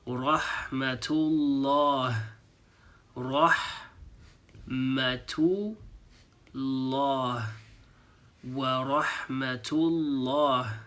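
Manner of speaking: Rahmatullah stretched very slowly; slow demo. Shown as a demonstration. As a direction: draw out Rahmatullah for learners. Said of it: "now we're saying".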